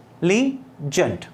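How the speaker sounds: Intelligent split into four separate syllables. Shown as a demonstration. Teacher shows four syllables in intelligent, syllable by syllable. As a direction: say intelligent slowly as in, te, li, gent. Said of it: "इसका मतलब four इसमें syllable है".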